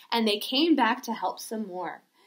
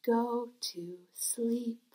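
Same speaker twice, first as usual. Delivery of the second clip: whispered and softer; 2 semitones lower, 8 dB quieter than usual. Pretend. Teacher whispers the bear to sleep, hushed. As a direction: breathe it like a lullaby to a sleeping bear.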